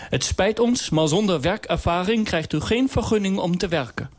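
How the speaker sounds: high, mock-formal official; "het spijt ons" in a high, stiff official voice. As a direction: play a pompous clerk, pitched high. Mock bureaucrat voice.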